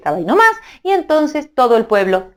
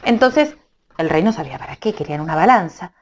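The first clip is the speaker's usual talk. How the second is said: ordinary delivery, nothing marked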